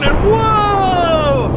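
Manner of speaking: loud, high, drawn-out "whoa"; high astonished shout; amazed. Real, not acted. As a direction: cry out in awe at the replay. Amazed at the slow-motion footage.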